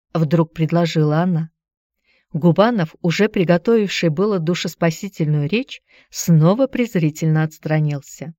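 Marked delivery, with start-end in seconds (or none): none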